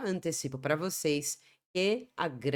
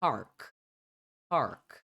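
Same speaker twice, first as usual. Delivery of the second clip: American "park" with a sounded r; American pronunciation; 6 semitones lower than usual. Shown as a demonstration. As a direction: say park with a full r before k. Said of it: "Já no americano".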